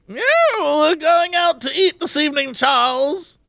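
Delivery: put-on high, lightly posh older diner voice; a hint of a refined older gentleman. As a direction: play a well-to-do retiree planning dinner.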